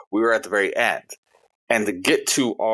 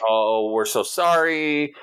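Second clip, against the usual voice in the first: higher, very drawn-out mock apology; apologetic staff; 6 semitones higher, much slower than usual. Restaurant host putting off waiting diner, mock apologetic. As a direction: imitate a host, stretching the apology out slowly.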